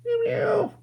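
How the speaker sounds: very high playful meowing; cat meow. Pretend. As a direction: meow like a little kitten. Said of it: "and say meow and peekaboo".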